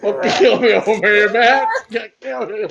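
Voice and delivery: high, wailing fake sob; hammed-up tearful whine, breaking into laughter; mock sobbing. Friend overwhelmed by his co-host, mock sobbing. Breaking into an exaggerated crying fit.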